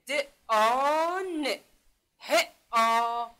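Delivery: د، آ، ن، ه، آ very slowly, each آ held long; sounding out. Shown as a demonstration. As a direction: stretch each آ in د، آ، ن، ه، آ. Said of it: "در صدا کشی خوب دقیق باشین".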